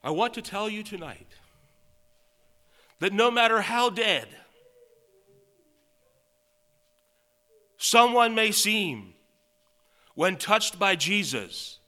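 preacher promising the congregation, full force; preaching at full force. Acted. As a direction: drive the point home to the congregation.

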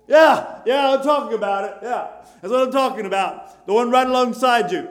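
full-force preaching, loud and fervent; loud, fervent pulpit preaching. Acted. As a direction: preach it out to the congregation like a revival. Preacher exhorting the congregation.

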